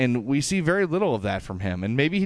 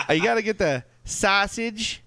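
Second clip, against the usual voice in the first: thick Chicago accent, higher and slow; 9 semitones higher than usual. Shown as a demonstration. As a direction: ham up a heavy Chicago accent on sausage. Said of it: "Can you break down how to do a Chicago accent".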